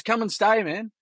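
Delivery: Kiwi bloke voice. Retold or imitated. Kiwi bloke inviting the family over for beers, high imitated Kiwi accent. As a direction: hand over the address like a friendly Kiwi, voice lifted high. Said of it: "And he goes".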